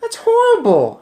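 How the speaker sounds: very high, loud outcry; shocked child's cry; horrified cry. A narrator's reading. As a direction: cry out in horror. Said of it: "I cried".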